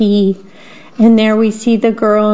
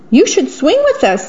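cheery monkey voice; 8 semitones higher, faster than usual. Acted. Monkeys inviting Jim.

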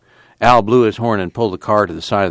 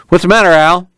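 raised, high-pitched call; 11 semitones higher, 6 dB louder than usual. A narrator's reading. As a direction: call over a running motor. Calling to Al over the motor.

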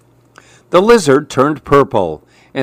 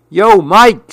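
higher, louder lizard greeting; 8 semitones higher, 4 dB louder than usual. Acted. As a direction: call out like a cool lizard. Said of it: "said the lizard".

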